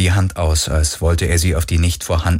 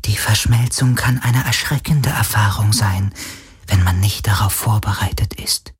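whispering ghost; 2 semitones higher than usual. Acted. Ghost explains the merging to Galen, whispered.

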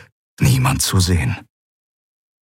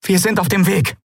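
high, emphatic orders; calling out; 13 semitones higher than usual. A narrator's reading. Captain promising the crew help is coming, urgent calling. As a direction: call out instructions urgently, much higher. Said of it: "rief Endriel".

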